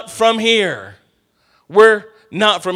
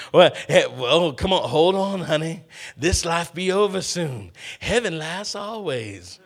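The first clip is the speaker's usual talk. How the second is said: Color Purple impression; 7 dB quieter than usual. Preacher quotes Color Purple to someone stuck here, lightly acted soothing voice. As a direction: lightly play a film character soothing someone, trailing softer. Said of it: "that's an inference to Color Purple".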